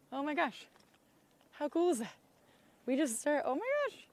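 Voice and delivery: high, thrilled gasps at the hovercraft; excited. Real, not acted. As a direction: gush with delight as it arrives.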